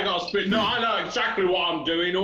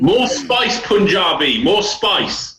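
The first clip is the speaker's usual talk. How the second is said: mock Punjabi accent; 3 semitones higher, 9 dB louder, much slower than usual. Teasing his friend with a loud mock Punjabi accent. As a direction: call out for more spice in a hammed-up Punjabi accent.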